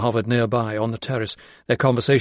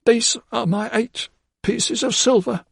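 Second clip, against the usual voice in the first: croaked; 11 semitones higher than usual. A narrator's reading. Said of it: "she croaked".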